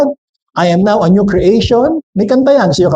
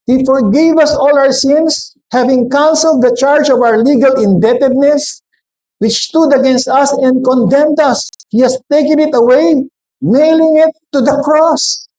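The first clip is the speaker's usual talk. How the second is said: slow, raised preaching read of the verse; scripture reading; 4 semitones higher than usual. Acted. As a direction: basahin nang mabagal at mataas ang boses. Reads scripture aloud. (mabagal na pagbasa ng Kasulatan)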